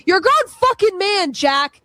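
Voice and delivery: angry yelling. Real, not acted. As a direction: tell him off, furious.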